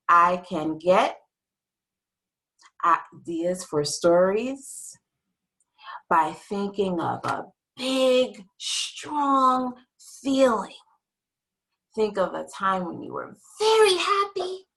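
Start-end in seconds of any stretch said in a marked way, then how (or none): 0.1-10.8 slow and drawn out, word by word; slow for repeating. Shown as a demonstration. Teacher leading the class to repeat the goal, slow. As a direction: spell out each word so the class can echo it. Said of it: "repeat after me".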